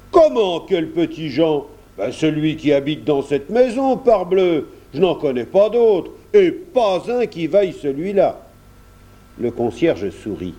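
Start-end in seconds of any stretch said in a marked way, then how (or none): 0.1-1.7 loud, high burst of disbelief; indignant surprise. A narrator's reading. Kersac indignant at the question. 2.0-8.4 high, emphatic farmer's protest; indignant countryman. A narrator's reading. Kersac insists, indignant.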